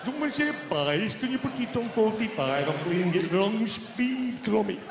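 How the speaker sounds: very slow mock Lancashire voice; wrong-speed Lancastrian. Mimicking Northern woman asking after pies, slow-record drawl. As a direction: draw out the words like a slowed-down record. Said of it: "sounds like you're not playing at the right speed".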